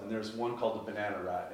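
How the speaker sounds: ordinary delivery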